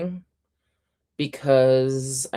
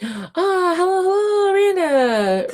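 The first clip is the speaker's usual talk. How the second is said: excited greeting; 4 dB louder, slower than usual. Real, not acted. Greeting a viewer in chat.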